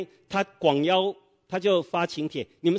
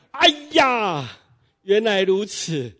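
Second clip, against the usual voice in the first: loud, much higher aha, then slowed down; sudden realization; 6 semitones higher, 6 dB louder, much slower than usual. Acted. Neighbour finally grasps the angel's rule, loud drawn-out surprise. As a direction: let the penny drop out loud.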